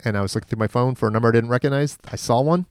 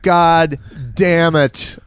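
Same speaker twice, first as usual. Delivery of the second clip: higher, slow, stretched God damn it; drawn-out exasperated curse; 6 semitones higher, much slower than usual. Retold or imitated. Retelling realizing it was the bartender, slow exasperated curse. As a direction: drag out God damn it in mock despair. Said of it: "And I was like".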